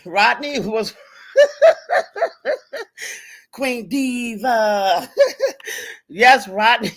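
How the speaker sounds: talking through giggles; slow, drawn-out words dissolving into laughs; real laughter. Real, not acted. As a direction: keep breaking into laughter mid-sentence. Cracking up while telling about Rodney crying.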